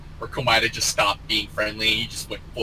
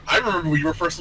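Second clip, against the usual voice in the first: ordinary talk, nothing marked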